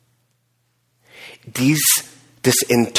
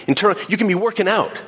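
normal talking voice

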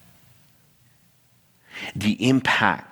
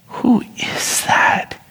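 puzzled whisper; much slower than usual. Retold or imitated. Glancing at tailgater in mirror.

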